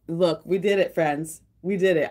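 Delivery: ordinary talk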